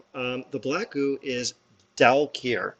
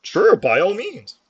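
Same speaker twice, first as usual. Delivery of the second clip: jolly boss; 8 semitones higher, 7 dB louder, slower than usual. Acted. Boss character in voice.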